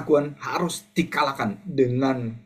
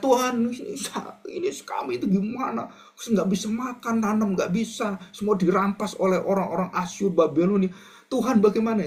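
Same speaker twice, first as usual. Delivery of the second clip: much higher, slowed, half-whispered lament; complaining exile; 7 semitones higher than usual. Acted. Israelites in exile complaining to God, high whining lament. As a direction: cry to God as a despairing captive.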